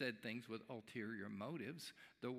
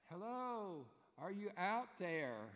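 raised, drawn-out call into the rain; calling out into the dark; 7 semitones higher, slower than usual. Retold or imitated. Husband calling into the rainy night for the stranger, a raised slow call. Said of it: "he calls out".

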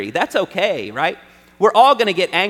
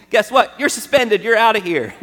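mock stern warning; 5 semitones higher, 5 dB louder than usual. Warning kids about punishment.

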